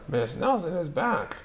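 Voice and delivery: lightly voiced stubborn principal; principal insisting. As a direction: principal refusing to be fooled. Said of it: "said Blansworth".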